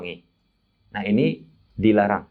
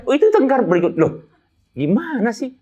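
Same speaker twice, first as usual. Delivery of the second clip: higher, louder exclaimed comeback; 1 mock indignant retort; 11 semitones higher, 5 dB louder than usual. Preacher retelling his retort to a teasing pilgrim, higher and louder. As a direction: pretend outrage, pitched up and loud.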